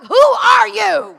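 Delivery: loud, high, drawn-out mock outrage; astonished shout. Son confronting the lady who converted his father, astonished shout. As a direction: stretch it out in comic disbelief.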